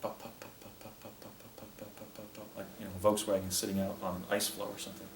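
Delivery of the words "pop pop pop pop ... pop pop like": soft unvoiced pop pop of an idling engine; engine popping. Imitating a hovering craft's engine, soft breathy pops. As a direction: quietly puff out the putter of a small motor. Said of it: "noise of a, of a combustion type engine".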